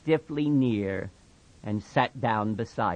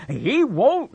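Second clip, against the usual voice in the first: higher, louder frantic cry; 12 semitones higher, 6 dB louder than usual. Acted. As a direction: blurt it in panic. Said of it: "Lenny cried frantically".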